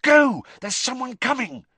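high, loud and urgent; urgent hiss. A narrator's reading. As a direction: push him away fast. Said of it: "he hissed at Sirius".

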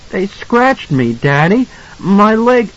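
put-on high, slow child's voice; pleading little boy showing a hurt; hurt small boy. A narrator's reading. Boy tells his father of scratches, high and plaintive.

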